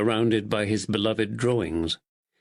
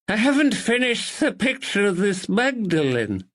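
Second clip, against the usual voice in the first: muffled voice; 9 semitones higher than usual. Acted. Narrator voices Billy.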